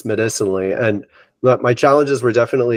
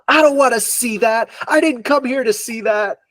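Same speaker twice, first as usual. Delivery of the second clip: much higher mock scream of protest; retold scream; 12 semitones higher than usual. Woman in the training screaming at Anna, much higher protest. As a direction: pitch it way up, like a panicked outburst. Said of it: "she's just screaming at Anna".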